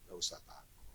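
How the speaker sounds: whispered, low comfort; reassuring whisper. Real, not acted. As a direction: whisper it like a promise. Reassuring congregation. (bisikan menenangkan)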